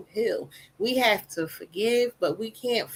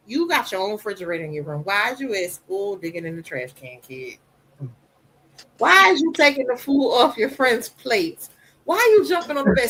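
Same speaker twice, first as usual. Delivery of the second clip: scolding mother; 3 dB louder than usual. Retold or imitated. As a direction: tell the kid off sharply. Scolding her son.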